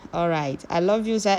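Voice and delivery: ordinary talk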